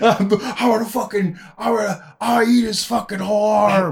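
high, hammed-up madman voice; crazed biter. Acting out a biter threatening onlookers, crazed mock yell. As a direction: rave like a flesh-eating brawler. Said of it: "say something like I just said".

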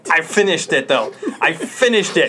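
loud laughing protest; insisting while laughing. Real, not acted.

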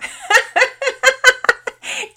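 high, loud burst of laughter; acted high-pitched cackle of delight; cracking-up laughter. A narrator's reading. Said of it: "Missus Witters cracked up".

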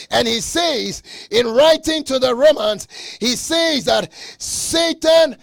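loud, ringing pulpit proclamation; preaching at full force. Acted. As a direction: preach it out to the whole church.

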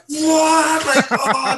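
a high, loud, disbelieving "What?"; shocked what. Retold or imitated. Shocked at being called off.

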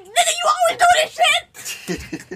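very high, squealed mock outburst; retold exploding anger. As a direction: blow up in a high-pitched retold tirade. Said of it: "and I exploded too".

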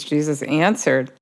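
ordinary talk, nothing marked

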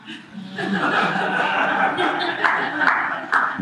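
a long, high, breathy burst of laughter. Real, not acted. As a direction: crack up at the punchline. Laughing at friend's baptism argument.

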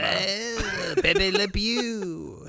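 slow mock French; drawled Pepe Le Pew accent. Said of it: "has a French accent".

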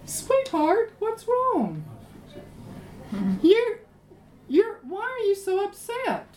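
high, sugary coaxing wife; a sweet high wife's voice asking her pouting husband what's wrong; Jezebel coaxing. Retold or imitated. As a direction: coo it up high like a doting wife. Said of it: "said to him".

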